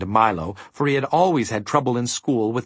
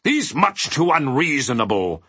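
higher-pitched, irate character voice; fuming, raised-pitch protest; indignant interruption; 5 semitones higher, 3 dB louder than usual. A narrator's reading. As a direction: cut in with indignation. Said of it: "interrupted the mathemagician again".

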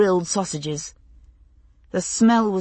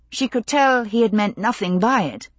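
ordinary delivery

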